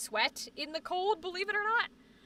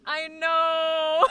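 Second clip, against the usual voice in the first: mock wail; 3 semitones higher, 7 dB louder than usual. Acting out her own whiny reply.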